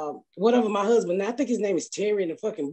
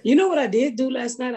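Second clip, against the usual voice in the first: ordinary delivery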